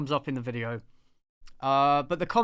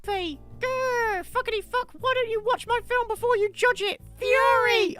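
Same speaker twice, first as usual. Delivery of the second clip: much higher squeaky mock rage. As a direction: squeal it like a petulant tantrum. Parodying [name]'s angry tweet.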